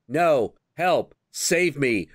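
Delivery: mock plea with little panic; understated imitation of a cry for help; half-hearted damsel retake. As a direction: play a bad actress going through the motions. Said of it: "they have to do another take".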